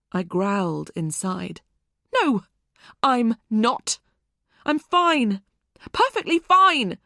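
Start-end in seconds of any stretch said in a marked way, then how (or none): 2.1-7.0 high, loud, insisting she's fine; shouting. A narrator's reading. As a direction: shout the denial, losing control. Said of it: "But I was shouting".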